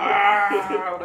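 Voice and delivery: very loud, high, held mock scream; imitated stage yell. Imitating the first thing the crowd hears, loud high yell. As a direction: belt a long "aah" amid laughter. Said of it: "that's the first thing you hear".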